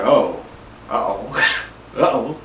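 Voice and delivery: low, quiet uh-ohs; retold dismay. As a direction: mimic a sinking realization, low and subdued. Retold dismayed reaction to seeing her.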